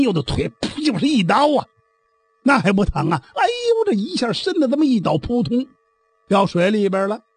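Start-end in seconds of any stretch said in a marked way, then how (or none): 3.4-3.9 high, sudden yelp of pain; cry of pain. A narrator's reading. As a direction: yelp as the knife cuts the leg. Stabbed in the leg. (挨刀后高声惨叫)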